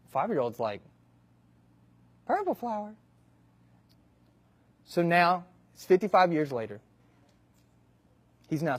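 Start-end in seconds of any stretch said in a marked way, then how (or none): 2.2-2.9 put-on high, soft confused little kid; small boy quietly puzzled; puzzled child. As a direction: repeat it, baffled.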